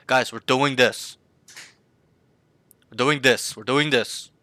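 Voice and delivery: firm, deliberate team command; confident, clear call. Shown as a demonstration. Coach showing student how to call to the team, confident. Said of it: "Be confident, loud and clear".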